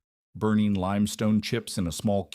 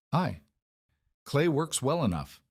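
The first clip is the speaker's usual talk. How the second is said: lightly voiced Yorkshire accent, pitched higher; a higher-pitched touch of Yorkshire in the voice; 6 semitones higher than usual. A narrator's reading. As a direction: narrate the reply with a light Yorkshire lilt. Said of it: "he replied in his Yorkshire accent".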